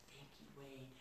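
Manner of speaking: very soft, low, near whisper; hushed gratitude. Retold or imitated. Handing her mentor the CD, hushed thanks. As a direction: fade almost to a whisper. Acting humbly handing CD over.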